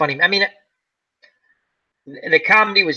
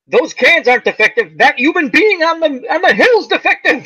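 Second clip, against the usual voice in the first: boss quote; 9 semitones higher than usual. Retold or imitated. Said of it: "He goes".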